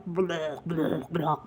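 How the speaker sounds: low, slow possum babble; imitated possum noises. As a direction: draw out low animal gibberish. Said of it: "It doesn't speak English".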